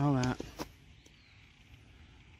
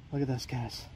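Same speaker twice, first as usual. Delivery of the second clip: ordinary delivery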